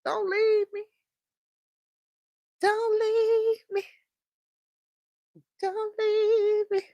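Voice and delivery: high, slow, whiny plea; mock plea. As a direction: plead in a high, stretched-out voice. Playful plea to viewer leaving.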